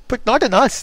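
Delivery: high alarmed protest; oysters crying out. Acted. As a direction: squeal it in sudden fright. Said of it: "the oysters cried".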